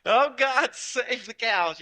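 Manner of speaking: high, loud desperate plea to God, then slow; a panicked prayer shot out at a runaway rocket; frantic prayer. Retold or imitated. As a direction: beg heaven to spare the cows. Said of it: "the fastest prayer I ever got out".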